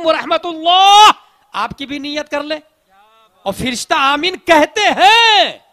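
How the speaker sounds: loud, ringing sermon, pushing each line; full-force preaching. Acted. Preacher urging listeners to greet worshippers, loud and fervent. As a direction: preach it to the back of the hall.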